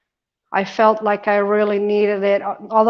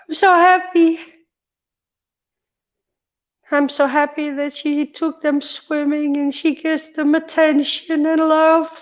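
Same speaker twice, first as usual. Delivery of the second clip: overjoyed; 6 semitones higher than usual. Real, not acted. Telling viewers about the dogs swimming, high happy gush. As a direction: gush with joy, much higher. Thankful for her dogs' swim.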